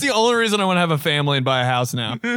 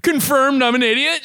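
higher-pitched mock proclamation; playful, raised-pitch declaration; mock self-announcement; 10 semitones higher than usual. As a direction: announce your own blunder to the room. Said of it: "everybody's like".

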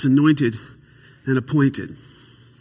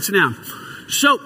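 ordinary delivery

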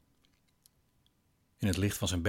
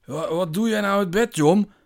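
much higher and louder, a stumbling stern scolding; a tipsy dad catching his son out of bed; stern, loud father with a stammer; 12 semitones higher, 10 dB louder than usual. Acted. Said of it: "zegt zijn vader streng".

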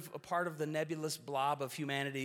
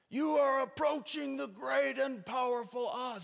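much higher, slow, booming mock wizard proclamation; 10 semitones higher, 4 dB louder than usual. Preacher playing the Oz figure greeting visitors, booming and slow. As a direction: boom it out like the wizard behind the curtain. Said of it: "picture the Wizard of Oz".

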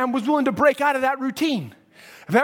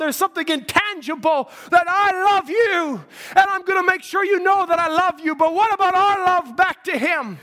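fiery preaching; 5 semitones higher than usual. Acted. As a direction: preach it with full fire. Preaching at full force.

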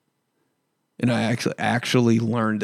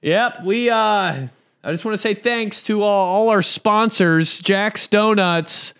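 much higher, slow goofy announcer voice; mock acceptance speech; 8 semitones higher, slower than usual. Mocking dad at a football podium in a high, slow voice. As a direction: ham up a small-town coach thanking sponsors. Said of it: "and be like".

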